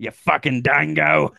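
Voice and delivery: high, slow mock-Australian insult; hammed-up Australian accent. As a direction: draw out the insult in broad Australian. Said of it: "got an Australian accent".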